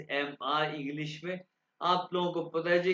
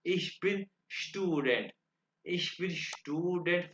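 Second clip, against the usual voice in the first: slow demo; 3 dB quieter, much slower than usual. Shown as a demonstration. Teacher models German sentence slowly.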